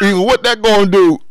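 high, loud mock outburst; mock yell. Friend teasing, high and loud.

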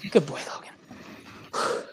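whispered and slow, to the dog; soft dog praise. Real, not acted. The owner praising her dog Logan, whispering. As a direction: praise the dog under your breath.

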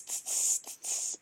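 hissing sizzle sound; whispered sizzling "tss"; sausage sizzle. Acted. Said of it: "it goes tss".

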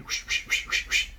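soft airy whoosh noises. Shown as a demonstration. Explaining words pass through, soft whooshing sound. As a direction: hiss out the whooshes. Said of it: "It's like floss in here".

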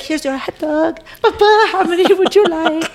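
high, bright pretend seller voice; child playing vendor. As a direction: play a little hot dog seller. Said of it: "I'd be like".

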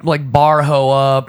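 loud, slow, manic jeering; manic jester voice. Retold or imitated. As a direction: goad him like a reckless inner jester. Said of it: "that jester was just like".

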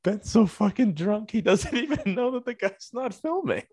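high, laughing through the words. Real, not acted. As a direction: tell it while cracking up.

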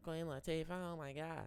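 a hammed-up starstruck gasp in a high voice; mock gasp. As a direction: imitate people gasping over a celebrity. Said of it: "everybody's like".

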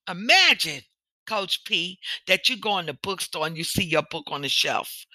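normal talking voice

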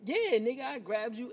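loud, cocky comeback; brash yell of a New Yorker; cocky retort. Retold or imitated. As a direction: throw it back at her, proud of it. Retelling a cocky confrontation.

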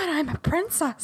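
whiny princess protest. Retold or imitated. Co-host voices the princess protesting.

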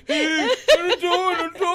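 very high squeal of pretend dismay; hammed-up panicky squeak; mock alarm. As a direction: wail it high, half laughing, as the hexed victim. Acting out the curse victim's shock.

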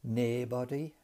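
Geordie "naebody" for nobody; Geordie pronunciation. Shown as a demonstration. Teacher quotes the Geordie speaker for students, Geordie accent. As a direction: imitate a Geordie saying "naebody", first vowel as "nay". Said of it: "And he says".

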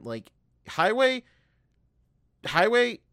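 highway said high, loud and slow; emphatic highway. Retold or imitated. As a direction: lean hard on highway, highway. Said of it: "highway repeated".

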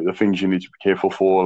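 ordinary delivery

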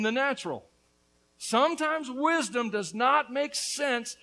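loud, high-pitched preaching, building; impassioned preaching. Acted. Pastor preaching to the church on the prodigal's father, loud and fervent. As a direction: preach it hard to the congregation.

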